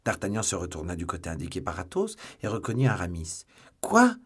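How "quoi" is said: very high, loud astonished cry; even more astonished. A narrator's reading. D'Artagnan recognizing Aramis as second witness, astonished cry. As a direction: shout it out, stunned. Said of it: "d'un accent plus étonné que la première fois".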